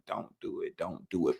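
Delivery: half-whispered warning to himself; hushed inner voice. Retold or imitated. Tempted man warns himself, hushed and low. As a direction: breathe it low like a conscience.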